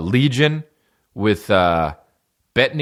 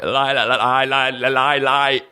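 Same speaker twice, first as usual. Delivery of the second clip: exaggerated stutter, higher-pitched; stuck on the syllable, struggling to get words out; stuttering king; 7 semitones higher, slower than usual. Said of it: "trying to fucking speak".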